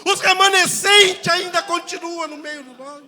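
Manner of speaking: shouted preaching, pitched very high; preach at full force. Real, not acted. Pastor proclaiming what the remnant still does, shouted preaching.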